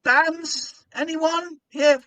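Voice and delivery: very high, slow, strained cheer; forced crowd work. Retold or imitated. Compere stalling before a hostile crowd, strained cheer. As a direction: flail for anything to say. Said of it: "So I've s-, um".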